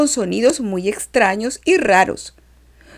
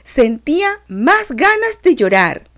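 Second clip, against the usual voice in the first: growing fear; 8 semitones higher, 5 dB louder than usual. A narrator's reading. Frightened child fleeing in dream.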